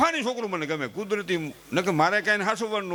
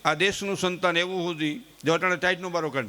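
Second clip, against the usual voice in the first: ordinary talk, nothing marked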